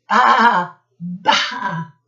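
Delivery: drawn-out mock sheep baa; sheep bleat. Reading animal noises to children.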